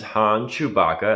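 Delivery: usual speaking voice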